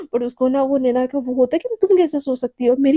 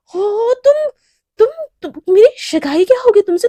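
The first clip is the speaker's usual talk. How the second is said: flustered stammer; 7 semitones higher, 7 dB louder than usual. Acted. Flustered Naina stammering at Shivani.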